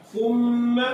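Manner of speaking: "ثم" with long nasal hum on m; ghunnah. Shown as a demonstration. Teacher giving another shadda example, ghunnah. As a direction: hold and hum the doubled m in "ثم". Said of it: "کیوں گننا کریں گے؟".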